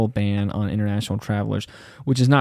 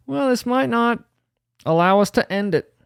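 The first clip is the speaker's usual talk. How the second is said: mock squeaky Biden; 11 semitones higher than usual.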